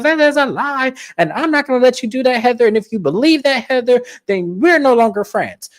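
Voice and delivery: high-pitched mock protest; indignant denial. Lisa threatens the friendship, indignant. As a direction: act out an outraged friend breaking it off. Acted quarrel, Lisa denies it.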